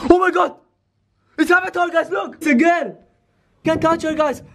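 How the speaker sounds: startled excited yell; excited shout. Real, not acted. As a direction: blurt it out in shock. Spots figure in the dark.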